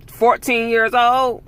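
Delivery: incredulous. Real, not acted. Appalled at parents taking a fourteen-year-old, slow incredulous outburst. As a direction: stretch out each word in shock.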